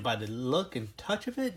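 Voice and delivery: ordinary talk, nothing marked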